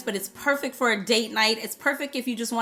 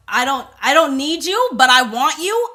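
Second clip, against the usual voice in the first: louder, rising on each "you"; loud, self-assured challenge; bold declaration; 2 semitones higher, 7 dB louder than usual. Acted. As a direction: declare it boldly, voice lifting at each phrase end. Voices the fragrance's bold attitude.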